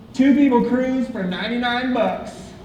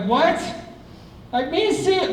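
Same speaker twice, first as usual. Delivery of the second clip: higher, rising disbelief; surprised insistence; 7 semitones higher than usual. Retold or imitated. Mock surprise telling a friend at breakfast. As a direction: insist it really was him. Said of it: "I'm like".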